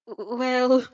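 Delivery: halting, stumbling start; stammering. Acted. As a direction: trip over the first word. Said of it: "you stammer".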